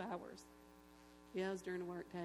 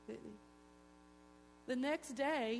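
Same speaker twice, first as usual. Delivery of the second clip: ordinary talk, nothing marked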